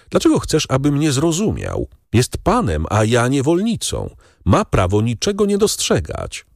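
plain reading voice

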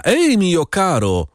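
przeciągłe, wyższe wołanie do woźnicy; zawołaj powoli i wysoko, bez pełnego krzyku. A narrator's reading. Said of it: "krzyknął do pocztyliona". (przeciągły okrzyk przywołujący)

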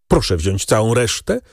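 usual speaking voice

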